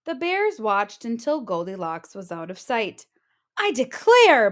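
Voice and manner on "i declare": loud, higher exclaiming mother; Mama Bear exclaiming after the fuss. Acted. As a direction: cry it in dismay. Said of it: "Mama Bear exclaimed".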